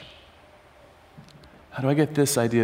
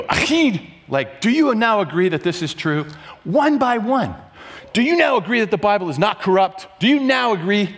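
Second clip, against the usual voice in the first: pressing questioner; 6 semitones higher than usual. Retold or imitated. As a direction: press each man for a yes. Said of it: "And he would go".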